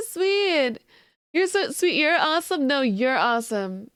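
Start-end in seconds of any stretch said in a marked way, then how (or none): none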